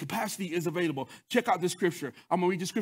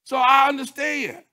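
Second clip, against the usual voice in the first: loud, high preaching, hammering each point; full-force preaching; 8 semitones higher, 6 dB louder than usual. Acted. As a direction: preach it hard to the congregation. Preacher rises on credit card story.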